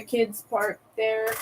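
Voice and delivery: ordinary talk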